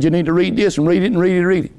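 fast, rattled-off repetition; rapid urging. Retold or imitated. Preacher retelling his advice to Travis, fast. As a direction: rush the repeats like an insistent push. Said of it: "and I said".

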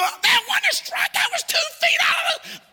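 very high mock yell at the umpire; protesting shout. Preacher imitating a fan arguing with an umpire, shouting. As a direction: screech it in acted outrage at the call. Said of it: "Thought you said you didn't shout".

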